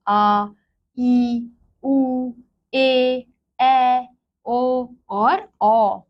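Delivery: each vowel आ to औ held long and slow. Shown as a demonstration. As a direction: stretch every long vowel for the class. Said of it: "दुगना समय लग रहा". (दीर्घ स्वरों को खींचकर बोलना)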